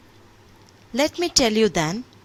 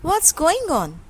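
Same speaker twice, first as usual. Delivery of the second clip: put-on a confused boy asking, pitched higher; puzzled boy; 7 semitones higher, 4 dB louder, slower than usual. A narrator's reading. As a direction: ask father what is happening. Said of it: "looks to his father and asks".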